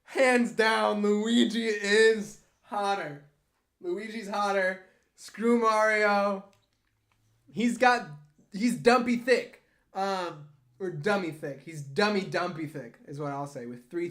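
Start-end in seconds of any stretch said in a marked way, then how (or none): 0.0-9.6 emphatic. Real, not acted. Emphatic verdict.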